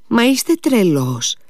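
high, agitated outburst; nervous exclamation. A narrator's reading. Lise tells Alyosha he is mad, nervously. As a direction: voice jumps up in nervous protest. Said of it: "πρόφερε νευρικά η Λίζ".

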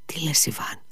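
breathless whisper of disbelief; shocked whisper. Acted. Alyosha whispering in shock at his brother's words. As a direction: gasp the words out under your breath.

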